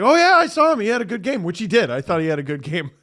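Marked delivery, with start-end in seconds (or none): 0.0-1.4 eager reply. Retold or imitated. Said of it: "I was like".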